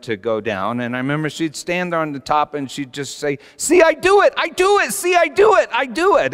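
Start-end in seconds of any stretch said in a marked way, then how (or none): 3.6-6.3 put-on high, loud kid showing off; excited small child. Three-year-old calling from the top of the slide, excited high shout. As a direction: call out like a proud toddler.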